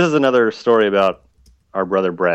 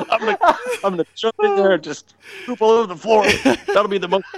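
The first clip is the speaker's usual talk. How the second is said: laughing; 11 semitones higher, faster than usual. Retold or imitated. Voicing brother's plan laughing.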